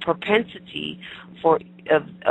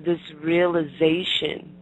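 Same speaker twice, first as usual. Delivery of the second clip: usual speaking voice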